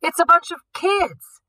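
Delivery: a guard shouting to the others across the smoky room; guard's yell. A narrator's reading. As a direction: yell it through the haze. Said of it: "one of the guards yell".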